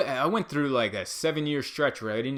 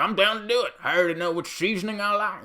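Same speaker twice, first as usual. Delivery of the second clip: higher, louder, bad mock pundit voice; Alex Jones impression; 9 semitones higher, 6 dB louder, faster than usual. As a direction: ham up a guttural talk-show host. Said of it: "that's not even a good impression".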